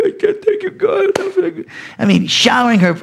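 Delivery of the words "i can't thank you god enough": mock crying. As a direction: fake being moved to tears with gratitude. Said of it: "he's, you know, he's crying".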